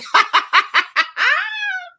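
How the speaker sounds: loud high-pitched laugh; high burst of laughter. Real, not acted. Vlogger laughing hard at a viewer comment. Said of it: "I love it".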